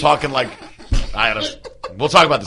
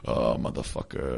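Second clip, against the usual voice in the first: much lower, slower, softer mock growl; low grumbling swear; 14 semitones lower, 12 dB quieter, slower than usual. Shown as a demonstration. Showing his co-host how he muttered, slow low growl. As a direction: drag it out low like a tired grouch. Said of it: "like that".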